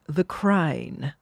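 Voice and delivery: "Crine": Crown said with an 'eye' vowel; posh British Crown, coming out as "Crine"; upper-class pronunciation. Shown as a demonstration. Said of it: "You have to say it like".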